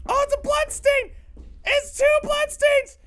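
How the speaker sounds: very high, panicked wail; alarmed cry. Real, not acted. Scared player spotting bloodstains, high alarmed cry. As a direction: cry it out high, stretching the words.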